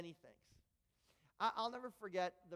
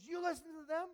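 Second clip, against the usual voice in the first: shocked; 9 semitones higher, 4 dB louder than usual. Retold or imitated. Wayne shocked.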